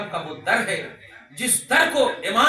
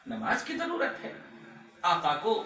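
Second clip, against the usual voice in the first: much softer, questioning; quiet rhetorical question; 7 dB quieter than usual. Real, not acted. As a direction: put the question softly to the crowd. Rhetorical question before the answer. (धीमे स्वर में सवाल)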